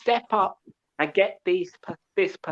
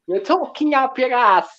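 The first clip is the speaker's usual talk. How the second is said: a light Jamaican accent, a touch louder; 5 semitones higher, 5 dB louder than usual. Shown as a demonstration. Telling rival fans off in a light Jamaican accent. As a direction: throw in a hint of Jamaican patois. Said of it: "as they say in a Jamaican accent".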